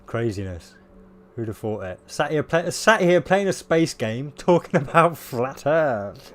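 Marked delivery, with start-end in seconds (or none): 2.0-6.3 laughing. Real, not acted. Amused at own irony.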